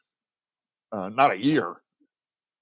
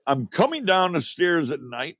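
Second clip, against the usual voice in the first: normal talking voice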